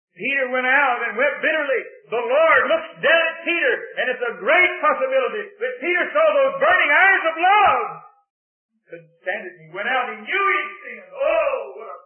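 loud, high impassioned preaching; fervent sermon climax; full-force preaching. Real, not acted. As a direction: cry it out over the congregation.

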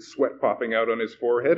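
usual speaking voice

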